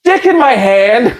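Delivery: high comic complaint breaking into laughter; mock dismay. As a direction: protest while starting to crack up.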